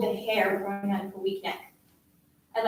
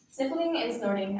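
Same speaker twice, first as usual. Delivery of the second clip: ordinary delivery, nothing marked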